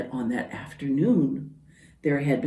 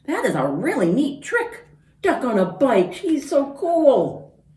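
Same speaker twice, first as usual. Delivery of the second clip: admiring dog; 8 semitones higher, 5 dB louder than usual. Acted. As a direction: gush like an impressed pup. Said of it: "what he thought was".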